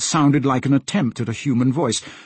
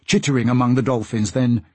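plain reading voice

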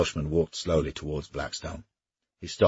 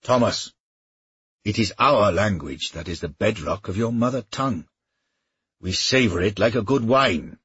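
a lightly raised, mocking Welsh knight; Welsh mercenary voice; 5 semitones higher, 5 dB louder than usual. Welshman taunting his old comrade, lightly mocking voice. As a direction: goad him with easy bravado. Griffith praises the Welsh tongue.

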